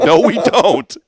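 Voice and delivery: laughing too hard to sound stern. Retold or imitated. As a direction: retell your reply while cracking up. Dad retells reply while laughing.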